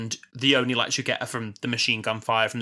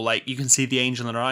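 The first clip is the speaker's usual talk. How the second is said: ordinary delivery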